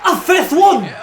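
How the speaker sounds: high, loud, laughing yell; laugh-shout in disbelief; excited shout. Real, not acted. Counting powerbombs in disbelief.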